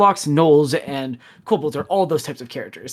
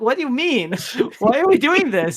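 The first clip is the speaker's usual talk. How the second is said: higher, half-laughing adventurer; exasperated player; 10 semitones higher than usual. Retold or imitated. Player pushing back at the quest giver, higher and laughing. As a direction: laugh in disbelief at the pointless task.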